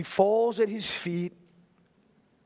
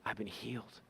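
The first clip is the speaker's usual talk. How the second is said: soft, lower, breathy-quiet whisper of wonder; awed whisper; 7 semitones lower, 13 dB quieter than usual. Retold or imitated. The healed woman telling Jesus, whispered in awe. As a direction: breathe it out in disbelief.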